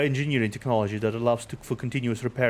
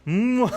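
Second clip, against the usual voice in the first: hearty laugh; 11 semitones higher, 4 dB louder than usual. Acted. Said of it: "laughter rang through the air".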